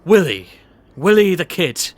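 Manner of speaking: booming, jovial posh boss voice; pompous boss. Acted. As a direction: play the bluff, overbearing company boss. S0 plays the boss Mr Bathurst in the sketch.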